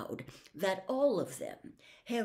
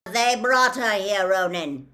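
loud, higher, forceful centaur voice; 3 semitones higher, 11 dB louder than usual. A narrator's reading. Centaur gripping Harry argues with Ronan, loud and forceful. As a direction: answer back with full projection. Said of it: "replied the centaur".

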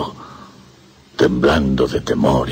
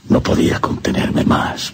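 ordinary talk, nothing marked

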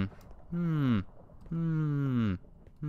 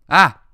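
sudden loud startled yell; 6 semitones higher, 15 dB louder than usual. Real, not acted. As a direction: yell out in shock. Sudden attack in game.

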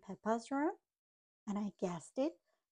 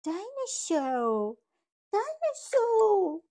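slow wail, climbing much higher and louder; crying George; 11 semitones higher, 7 dB louder, slower than usual. Acted. George finding his toy gone, slow crying wail. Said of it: "George starts to cry".

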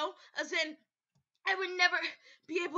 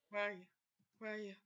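usual speaking voice

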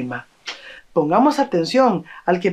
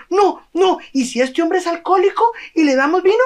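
much higher, fast fretful worry; alarmed objection; 10 semitones higher, faster than usual. Acted. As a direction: rush the worry out. Villager objects to the wine. (un vecino preocupado objeta rápido)

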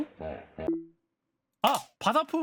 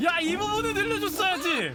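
indignant outcry; 8 semitones higher than usual. Real, not acted. Repeats complaint louder.

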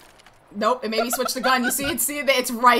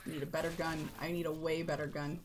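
much softer, half to himself; muttering under breath; 11 semitones lower, 18 dB quieter than usual. Real, not acted. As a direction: mutter it low and frustrated. Muttering to self.

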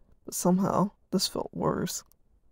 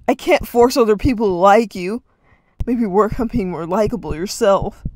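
loud, nasty shouting; spat nastily; 4 semitones higher, 12 dB louder than usual. Acted. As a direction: cut him down cruelly. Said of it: "Reed spat nastily".

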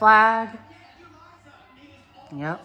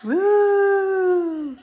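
excited cheer; 10 semitones higher than usual. Real, not acted. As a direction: cheer like the ball just dropped. New Year's Eve cheering.

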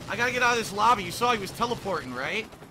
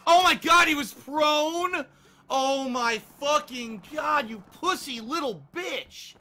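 high, loud, drawn-out exasperation; slow incredulous rant at an opponent; outraged yell; 6 semitones higher, 6 dB louder, slower than usual. Real, not acted. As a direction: stretch each curse in real outrage. Outraged at hidden enemy player.

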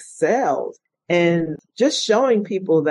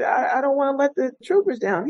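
near tears; 9 semitones higher than usual. Retold or imitated. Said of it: "And I almost started crying".

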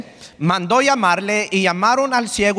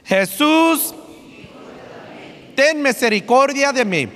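calling out; 4 semitones higher than usual. Shown as a demonstration. Pastor has everyone repeat the plea, calling out. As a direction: lead the room in a pleading call. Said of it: "Otra vez, todos juntos".

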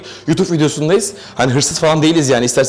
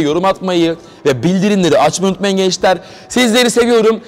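vlog outro; 5 semitones higher than usual. Acted. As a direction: wrap up the video like a host. Closing the video to viewers.

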